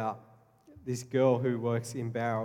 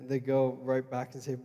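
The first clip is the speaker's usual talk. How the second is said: ordinary talk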